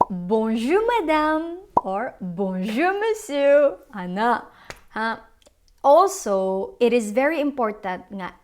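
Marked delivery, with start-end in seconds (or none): 0.1-3.8 "Bonjour" with rising tune, "monsieur" higher; French greeting. Shown as a demonstration. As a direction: model "Bonjour, monsieur" for the class to repeat. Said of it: "So you say".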